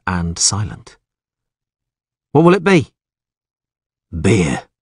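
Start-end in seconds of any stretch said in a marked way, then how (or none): 2.3-2.9 gruff innkeeper. Acted. Innkeeper greets the stranger. 4.1-4.7 a hint of a cold, unpleasant stranger. A narrator's reading. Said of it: "His voice was unpleasant".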